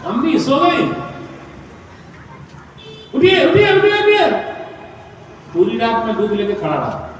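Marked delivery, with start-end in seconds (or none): 3.1-4.5 high, louder repeated call to rise; urgent waking call. Retold or imitated. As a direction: wake the parents, pleading. Waking sleeping parents.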